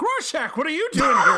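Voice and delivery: high, loud mock-alarmed greeting; comic high-pitched outburst at an unwelcome guest; startled shout. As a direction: yell it like a panicky bar owner, hammed up. Acts startled villain-bar owner greeting Rorschach.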